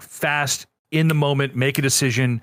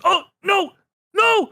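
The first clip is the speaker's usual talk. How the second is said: high, loud mock wail; imitating Brennan; 3 dB louder than usual. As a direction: squeal out in dismay. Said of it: "he just goes".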